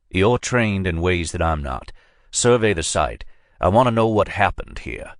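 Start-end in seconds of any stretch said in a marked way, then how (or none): none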